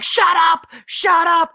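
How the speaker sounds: high, loud mock yell of shut up; an exasperated kid finally yelling at the chanters; snapping shout. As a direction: snap like you've finally lost it. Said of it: "finally snaps".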